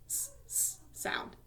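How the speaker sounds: s held as a bare voiceless sibilant, repeated; isolated S sound. Shown as a demonstration. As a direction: hiss the s by itself before saying sound. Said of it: "has that s".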